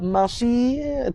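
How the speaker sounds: "masir" with the r swallowed; dropped final r. Shown as a demonstration. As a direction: stretch "masir" and leave the r unsounded. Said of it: "tak keluar huruf ra terus".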